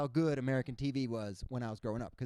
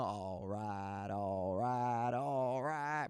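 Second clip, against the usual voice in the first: low, slow drawl; McConaughey impression; 6 semitones lower, 3 dB quieter, much slower than usual. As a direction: drawl it laid-back. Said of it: "Matthew McConaughey's breakout role".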